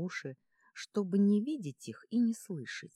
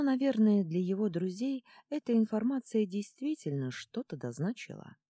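ordinary delivery, nothing marked

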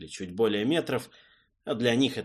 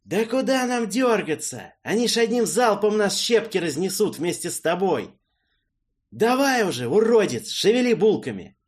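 loud, high, irritated shouting; angry yelling; 11 semitones higher, 7 dB louder than usual. Acted. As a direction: yell at him through the window. Character yells out the window.

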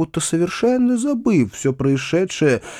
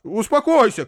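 high-pitched, urgent exclamation; calling out; 10 semitones higher than usual. A narrator's reading. Uncle tries to calm Foma, crying out. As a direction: cry out to calm someone. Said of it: "крикнул дядя".

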